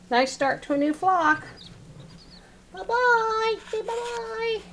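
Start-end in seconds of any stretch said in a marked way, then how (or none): 2.7-4.6 high-pitched cooing goodbye. Real, not acted. Keeper getting chicks to say bye-bye, high baby talk. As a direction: coo a high little farewell.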